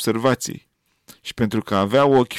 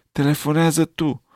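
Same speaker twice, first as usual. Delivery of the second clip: plain reading voice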